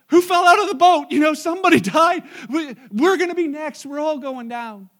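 loud, high panicked yelling with a laugh on died; panicked shouting. Acted. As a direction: scream it like men sure the boat is going down. Panicked disciples in storm boat.

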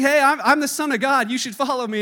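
loud, high proclamation; big brother's claim. Retold or imitated. Older brother telling his siblings he is God, loudly. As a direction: announce it boldly to the family.

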